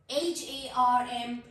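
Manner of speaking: slow letter-by-letter spelling. Shown as a demonstration. Teacher spelling a word for learners, slow spelling. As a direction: spell it out, a small gap after each letter. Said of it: "letter spell करती हूँ".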